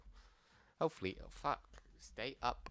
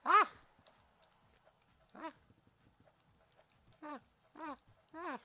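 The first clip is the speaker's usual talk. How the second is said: high panicked yelps; being chased; 18 dB louder than usual. Real, not acted. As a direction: squeal while being chased. Fleeing enemies in game.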